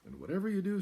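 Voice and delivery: high, loud earnest farmer voice; farmer warning. Acted. Farmer cautioning Cow about the tracks, high and loud. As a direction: make the farmer's warning ring out. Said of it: "said the farmer".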